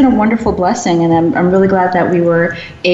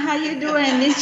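higher, softer mock Jamaican accent; 8 semitones higher, 7 dB quieter than usual. Shown as a demonstration. Co-host greets in a teased fake accent, Jamaican accent. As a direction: play at a Jamaican lilt, badly faked. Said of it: "This is my Jamaican accent".

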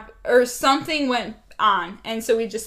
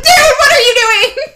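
high, loud laughing scream; 12 semitones higher, 12 dB louder, faster than usual. Real, not acted. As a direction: shriek it out, half laughing. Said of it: "I'm sorry, I'm screaming".

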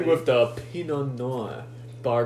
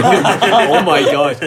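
laughing; 4 semitones higher, 12 dB louder, much slower than usual. Real, not acted. Cracking up at own reading.

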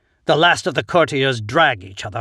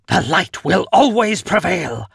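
raised, loud triumphant cry; 6 semitones higher, 5 dB louder than usual. A narrator's reading. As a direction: proclaim it over the fleeing crowd. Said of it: "I cry".

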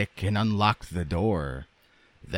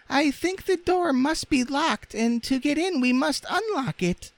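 very high, squeaky rag doll; Raggedy Ann's voice. A narrator's reading. Rag doll confessing muddled thoughts, squeaky. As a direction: speak in a tiny piping falsetto.

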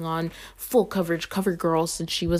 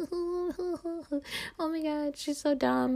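giggling mockery; 10 semitones higher, 5 dB quieter, much slower than usual. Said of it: "like, giggling".

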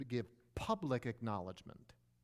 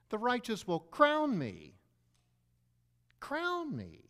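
higher and louder, slow and ringing; proclaimed; 10 semitones higher, 6 dB louder, slower than usual. Shown as a demonstration. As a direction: announce it like a coronation. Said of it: "how about this".

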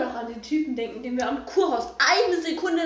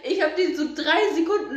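ordinary talk

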